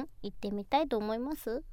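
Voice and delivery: ordinary delivery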